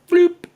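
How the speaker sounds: high, loud little bloop; sharp high-pitched sound effect; imitated switch noise. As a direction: pop it out as a sudden cartoon switch flip. Imitating the switch flipping in head.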